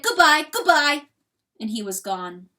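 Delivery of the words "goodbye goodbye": high, loud weasel goodbye; anguished farewell. A narrator's reading. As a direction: call out a last goodbye, pitched up. Weasel bids anguished farewell and leaves.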